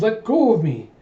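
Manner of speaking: high-pitched mock cry of protest. Princess pulled along by a suitor, protesting. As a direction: object sharply, pitch jumping up.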